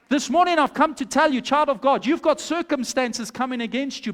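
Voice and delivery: ordinary talk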